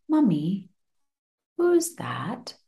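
put-on eerie child whisper; whispered child question. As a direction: breathe it like a child in the dark. Son asks in the dark.

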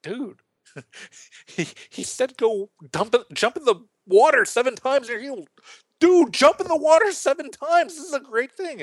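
high, rising exasperated urging; mock urging friends. Servants urging Naaman, amused and exasperated. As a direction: push a stubborn friend, half laughing.

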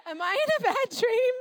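high, loud half-awake disbelief; jolted awake and aghast; groggy mock alarm. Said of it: "you're like".

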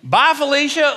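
high, sassy send-off; hammed-up brush-off; mock dismissal. Mock dismissal of woo girls.

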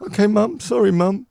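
high-pitched put-on little boy; child answering mum. Co-host playing himself as a boy obeying Mum, childlike. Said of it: "your childhood".

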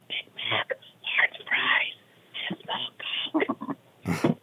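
soft, voiceless library whisper; exaggerated whisper. Customer apologizing to a librarian, hushed whisper. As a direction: whisper the order, spacing each item. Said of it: "Ma'am, this is a library".

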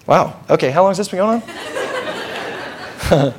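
casual, understated question after mock panic; unfazed reaction. Jesus watching the boy writhe, calmly unfazed. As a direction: play it cool and mildly curious. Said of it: "No, no, he's like".

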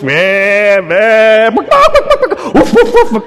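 high mock bleats and barks; goat and dog noises. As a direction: ham up the farmyard chaos. Animals filling the house.

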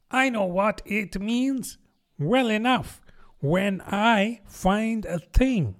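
high-pitched duck character; argumentative duck. A narrator's reading. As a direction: argue back in a high voice. Duck argues with the mouse.

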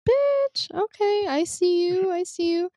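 high-pitched mock-impressed exclamation; playful, high-voiced approval; impressed reaction. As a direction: squeal it high, then ease down approvingly. Acted impressed reaction to a character.

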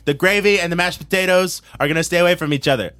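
hammed-up campaign promise; Donald Trump. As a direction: work the crowd as a mock politician. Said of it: "it's Donald Trump".

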